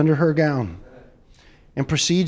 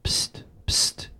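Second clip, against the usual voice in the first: small secretive hiss for attention; whispered psst. Retold or imitated. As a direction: get someone's attention with a hissed psst. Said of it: "he hears a little psst".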